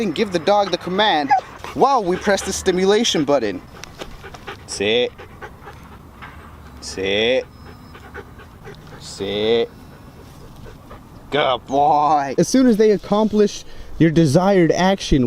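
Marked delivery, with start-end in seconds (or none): 4.7-9.7 talking to a dog. Real, not acted. Giving dog the sit command. 11.3-12.3 talking to a dog. Real, not acted. Praising the dog.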